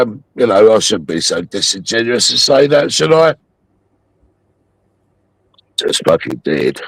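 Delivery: normal talking voice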